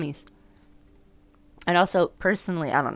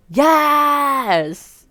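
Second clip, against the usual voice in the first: high, loud, long-held yes; excited cheer; 11 semitones higher, 10 dB louder than usual. Real, not acted. Player cheering the game's line, high excited shout.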